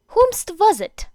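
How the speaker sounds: high, loud teasing demand; prying Mina. Acted. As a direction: pounce on him with a sly question.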